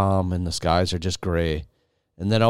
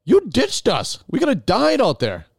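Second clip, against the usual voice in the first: much higher, louder, slow indignant complaint; mock outrage; 14 semitones higher, 4 dB louder than usual. Scolding Jerry for ditching them, high, loud and slow. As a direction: squeal the accusation at Jerry. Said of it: "And I'm like".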